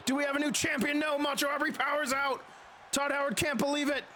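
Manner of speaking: lively ringside play-by-play; wrestling commentator. Acted. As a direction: call the match blow by blow.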